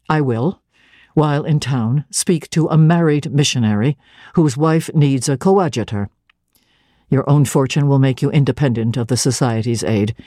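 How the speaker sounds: low, controlled and firm clergyman; emphatic but calm. Acted. Clergyman refusing her terms, calm emphatic low voice. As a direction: press each point calmly, voice kept low. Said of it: "emphatically but calmly".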